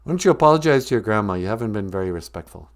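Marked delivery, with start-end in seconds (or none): none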